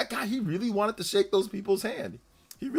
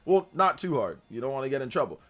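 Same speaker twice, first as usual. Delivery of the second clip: ordinary delivery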